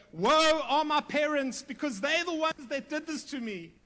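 high, loud self-pitying whine; mock a victim blaming everyone else; hammed-up whiny excuse. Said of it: "He doesn't go".

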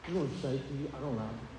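soft, humble admission; not bragging. Retold or imitated. Preacher confessing to God, quiet and humble. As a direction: say it quietly, no boasting. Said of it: "I was not bragging about it".

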